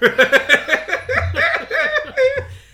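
big high burst of laughter; loud laugh. Real, not acted. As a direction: crack up loudly. Laughing at Jägermeister taste.

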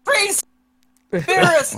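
mock high-pitched cry of names; imitated yell. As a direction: call it out high, like a put-on yell. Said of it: "I wonder if he yells".

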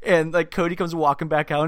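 normal talking voice

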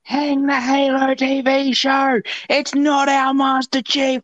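high, drawn-out protest cry at ordinary volume; stretched-out, high-pitched mob impression; mock fan yelling. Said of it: "most of the people yelling".

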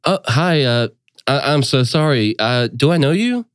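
an apologetic, unsure hostess; polite confused sister. Acted. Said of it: "sister addresses stranger".

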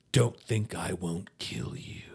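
low, slow hammed-up menace; imitated movie tough-guy threat; menacing threat. Acted threat with a pitchfork.

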